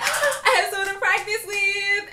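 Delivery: high, drawn-out gush of excitement; hammed-up thrill at high pitch; mock overjoyed squeal. Acts out her raised hopes.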